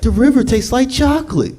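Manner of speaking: put-on very high, dreamy kid voice. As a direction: marvel at the chocolate river. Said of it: "It's like".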